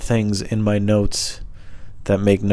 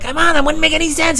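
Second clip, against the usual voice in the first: squeaky, very high pleading; high-pitched whiny objection; exasperated kid self; 4 dB louder than usual. Retold or imitated. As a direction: protest to mom in a childish squeal. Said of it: "And I'm like".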